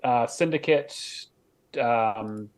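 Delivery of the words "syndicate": ordinary talk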